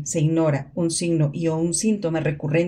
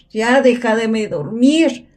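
loud scolding; 4 semitones higher, 6 dB louder, slower than usual. Acted. Scolding voice again.

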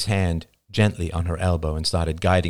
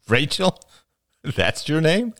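surprised; 9 semitones higher, slower than usual. A narrator's reading. Oliver surprised.

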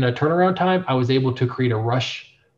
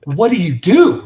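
excited disbelief; 6 semitones higher, 7 dB louder, slower than usual. Retold or imitated. Retelling amazement at hundred thousand.

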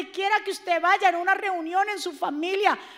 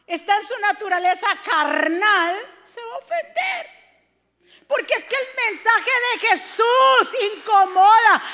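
louder sermon delivery; preaching; 4 semitones higher, 5 dB louder than usual. Acted. Pastor preaching to the church about offense. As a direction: press the point home.

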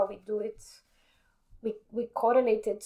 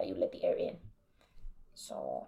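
whispering; 3 semitones lower, 7 dB quieter than usual. Shown as a demonstration. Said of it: "only through this very little hole".

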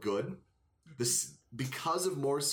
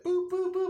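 high loud beeping boops; phone ring imitation; 6 dB louder than usual. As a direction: beep like a ringing phone. Imitating emergency phone ringing.

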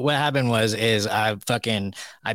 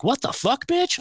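much higher, disbelieving outcry; shocked outburst. Retold or imitated. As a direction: squeal it out in stunned disbelief.

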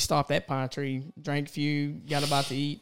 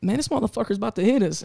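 higher, louder, fast alarmed cry; alarmed warning; 8 semitones higher, 5 dB louder, faster than usual. Retold or imitated. Warning Mitchell as a boat heads straight at them, fast and alarmed. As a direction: blurt it as the boat bears down.

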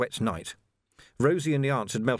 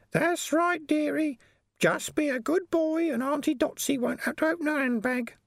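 slightly deeper aunt. A narrator's reading. Auntie Dotsy warning Vimes, high and sweetly threatening. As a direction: purr a menace like a kindly auntie. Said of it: "A slightly deeper one said".

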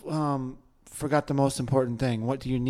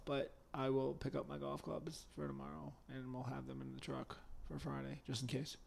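near whisper; 16 dB quieter than usual. Real, not acted. Cohost noting his golf plans for Friday, near whisper. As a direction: say it under your breath, almost whispering. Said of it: "you whisper that".

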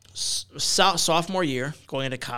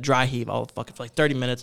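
ordinary talk, nothing marked

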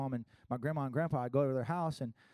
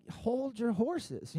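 slow patient dad; 7 semitones higher, much slower than usual. Retold or imitated. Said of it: "And it's like".

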